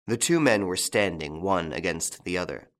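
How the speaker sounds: usual speaking voice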